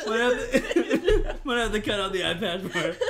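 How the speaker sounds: slow, words broken by laughter; can barely talk for laughing; laughing hard. Real, not acted. Repeated joke, laughing harder.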